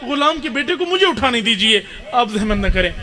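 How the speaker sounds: rising grief-laden lament, falling away at the end; impassioned majlis lament. Acted. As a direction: pour it out as a wailing climax, pitch rising. Climax of the lament recital.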